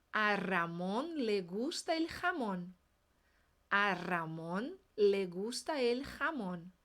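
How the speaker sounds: slow and careful; strong R in "Ramón", throat J in "jamón"; slow drill. Shown as a demonstration. Teacher drilling the R and J contrast slowly. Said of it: "vamos treinar".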